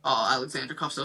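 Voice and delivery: ordinary talk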